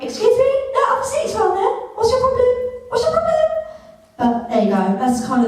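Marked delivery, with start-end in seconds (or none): 0.0-2.5 much higher, fast, snippy mimicry; mock indignant retort. Telling off the old person in priority seating, high snippy indignation. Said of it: "So I was like". 2.9-3.3 even higher, sharper snippy jab; mock indignant repeat. Repeating the jab at the old person, higher and sharper. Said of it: "So I was like".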